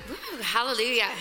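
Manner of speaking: ordinary talk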